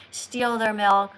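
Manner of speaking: ordinary talk, nothing marked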